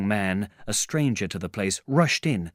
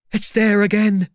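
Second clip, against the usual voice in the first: high, loud startled cry; alarmed tramp; 12 semitones higher, 10 dB louder than usual. Acted. Tramp hearing the bodiless voice return, alarmed.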